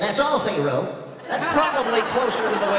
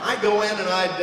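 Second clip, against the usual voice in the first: ordinary talk, nothing marked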